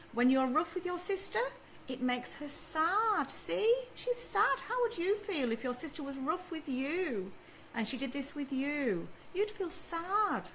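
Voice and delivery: high, slow exaggerated toddler talk. Shown as a demonstration. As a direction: spell out sadness for a small child. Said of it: "you can demonstrate what sad looks like".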